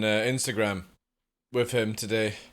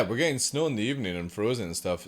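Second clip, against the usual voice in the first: ordinary delivery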